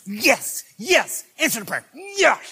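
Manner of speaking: high rising shouts of yes; a pastor cheering for an answered prayer; excited cheering. Pretend. Pastor in his office cheering for his people, rising excitement.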